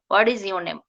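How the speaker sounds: What is your name flowing as "waris your name"; linked T. Shown as a demonstration. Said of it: "Just like this".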